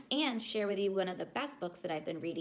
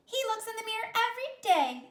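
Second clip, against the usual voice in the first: put-on high, loud, teasing girls; teasing sisters; 13 semitones higher, 7 dB louder than usual. A narrator's reading. Sisters teasing about their brother, high tattling.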